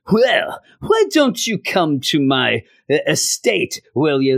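slow, badly faked British accent; terrible British accent. Comic-book host inviting guests to his estate, bad British accent. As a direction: put on a halting, hammy British voice. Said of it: "That's the worst British accent ever".

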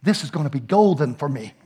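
low, hushed thought to himself; private thought. Retold or imitated. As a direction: say it low and soft, like a secret scheme. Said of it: "I'm thinking".

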